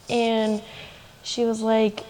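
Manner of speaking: usual speaking voice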